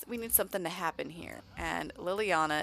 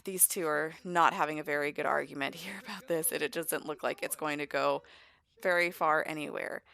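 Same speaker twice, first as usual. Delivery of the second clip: ordinary delivery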